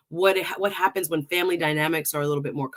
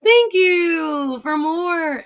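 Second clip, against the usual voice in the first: mock grateful; 8 semitones higher, 6 dB louder, much slower than usual. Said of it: "And I'm like".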